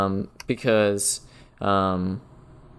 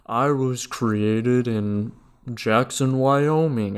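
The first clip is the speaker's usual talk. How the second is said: slow, measured robotic greeting; reset computer greeting; slower than usual. Retold or imitated. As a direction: drag each word like a rebooting computer. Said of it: "he's like".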